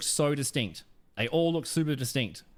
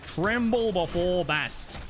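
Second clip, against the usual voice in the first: slow, grand, higher-pitched proclamation; mock battle cry; 5 semitones higher, much slower than usual. Gamer hamming up a hero's line mid-match, mock battle cry. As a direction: draw it out like a mighty warrior's threat.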